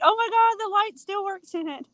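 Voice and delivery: high-pitched retold amazement; excited. Telling how the old lamp lit up, excited. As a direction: jump up high, reliving the delighted discovery. Retelling delight at working lamp.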